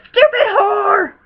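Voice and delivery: loud, high, drawn-out shout; sudden scornful holler; shouted insult. Real, not acted. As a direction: bellow the nickname, stretching it out. Shouts insulting nickname mid-recap.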